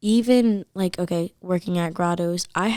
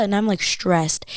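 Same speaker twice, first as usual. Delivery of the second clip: ordinary delivery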